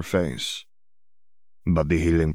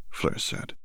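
ordinary delivery

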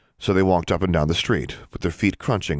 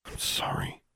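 soft unvoiced apology; whispering; 12 dB quieter than usual. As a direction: breathe the apology out. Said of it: "Alexandra whispered".

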